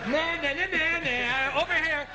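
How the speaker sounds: high, laughing singsong taunt. Retold or imitated. Comic taunting an old movie mummy, a playful laughing singsong.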